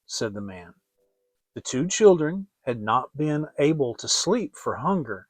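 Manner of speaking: ordinary delivery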